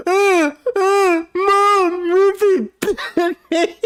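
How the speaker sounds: high yelping cries for mom; pained kid wailing. Retold or imitated. Kid bitten by his dog wails to his mom, high and loud. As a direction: wail it like a bitten crybaby. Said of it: "and he goes".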